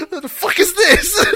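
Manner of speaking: high, loud laughing exclamation; laughing rant. Retold or imitated. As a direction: laugh out the outburst. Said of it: "maybe that pushed him".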